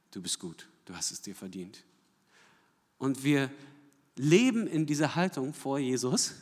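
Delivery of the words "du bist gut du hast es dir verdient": much softer, hushed words of approval; imagined father's praise. Pretend. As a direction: voice the longed-for praise quietly. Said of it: "dass unser Vater sagt". (leise, sanfte Zustimmung des Vaters)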